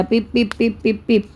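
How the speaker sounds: quick run of short, even beeps; imitated beeps. Shown as a demonstration. Teacher demonstrates the robot's caution signal, imitated beeping. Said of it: "signal થવા માંડ્યા".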